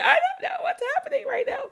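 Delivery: high-pitched comic wail; mock bawling. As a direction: whimper high in bewilderment, laughing through it. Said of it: "I just start bawling".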